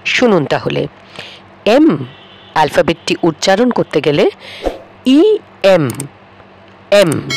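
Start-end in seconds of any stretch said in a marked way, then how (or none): 5.0-5.9 ই এম said as e-em, with a clear leading e vowel; ই এম: stretch the opening e before em; spelling out the letter M. Shown as a demonstration. Teacher showing students the letter M said as e-em. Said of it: "এইভাবে উচ্চারণ করতে হচ্ছে".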